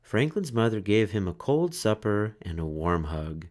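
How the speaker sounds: ordinary delivery, nothing marked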